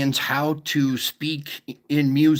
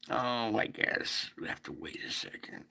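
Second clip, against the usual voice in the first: hushed breathy murmur to himself; whispered aside; 3 semitones lower, 12 dB quieter than usual. Real, not acted. Streamer waiting on the chat, whispering to himself. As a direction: drop to a whisper while killing time.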